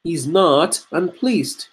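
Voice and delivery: slow, with "not" leaned on in "He's not unpleased"; stress on not. Shown as a demonstration. Teacher stresses the denial for his students. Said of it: "That makes for me much more sense".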